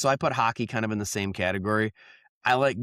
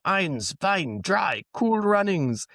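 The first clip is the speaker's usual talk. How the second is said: put-on higher German-accented movie chant; imitated German count-off; 8 semitones higher, much slower than usual. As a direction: chant it in mock German, pitched up. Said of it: "Why are you talking German then".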